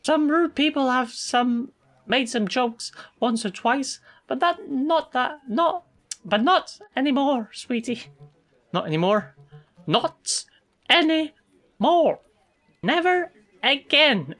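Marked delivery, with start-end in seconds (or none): 0.0-8.1 high, put-on lady's voice; sweet lady's accent. Coworker admitting people made jokes, high accented voice. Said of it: "I forgot to do her accent". 9.9-14.4 high, slow, word-by-word menace; scary finality. Acted. Coworker assuring the teasing stopped, high slow menace. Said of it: "She's quite scary, actually".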